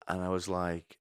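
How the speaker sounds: usual speaking voice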